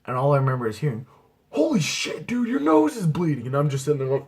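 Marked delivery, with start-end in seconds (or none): 1.5-3.5 high, loud alarmed yell; alarmed friend. Retold or imitated. Friend alarmed at his bleeding nose, high shocked yell. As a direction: shout it in shock at the blood.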